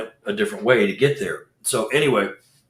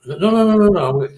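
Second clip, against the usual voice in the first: high, louder string of no's; alarmed no's; 9 semitones higher, 5 dB louder than usual. Retold or imitated. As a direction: protest sharply, voice jumping up. Protesting in retold scene.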